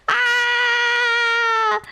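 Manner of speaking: high excited yelp; excited cry. Real, not acted. Exclaims after peeing story.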